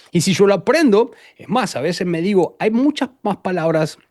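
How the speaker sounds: ordinary delivery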